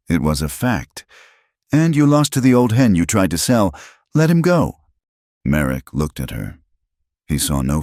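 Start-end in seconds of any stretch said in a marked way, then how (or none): 1.7-4.8 high, loud, forceful command. A narrator's reading. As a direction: order him firmly. Heroine commanding the villain.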